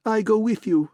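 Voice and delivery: excited man eagerly joining the quest; eager cry. A narrator's reading. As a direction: blurt it gleefully. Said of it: "The Levantine giggled and cried".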